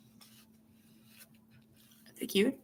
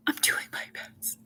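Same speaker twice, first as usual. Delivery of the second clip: whispered reply; 9 dB quieter than usual. Retold or imitated. Quoting her reply to critic.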